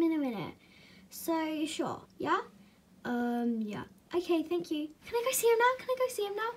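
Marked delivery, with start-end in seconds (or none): 5.1-6.5 high, eager repeated begging; eager pleading. Acted. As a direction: plead excitedly in a higher voice. Begging to see her horse.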